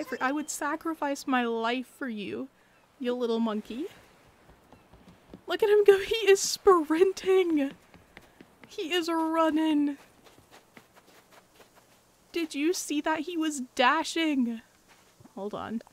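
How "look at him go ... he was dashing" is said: high, loud, slow adoring cheer; high loud doting squeal; delighted squeal. Real, not acted. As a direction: cheer on a tiny runner. Watching Nico run.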